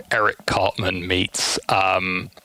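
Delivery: ordinary talk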